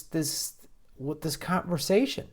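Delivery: usual speaking voice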